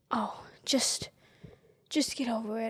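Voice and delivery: whispered dismissive advice; whispered mock advice. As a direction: breathe it out dismissively. Mock advice to grieving person.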